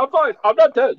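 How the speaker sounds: high-pitched, playful all-clear; hammed-up high little voice; mock reassurance. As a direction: pipe up high to reassure worried friends. Acts out reassuring worried friends.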